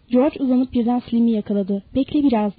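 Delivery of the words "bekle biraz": usual speaking voice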